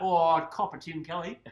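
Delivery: Busher impression. Mimicking co-host on football trades, loud mock impression. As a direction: caricature your friend's way of talking. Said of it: "Do a Busher accent".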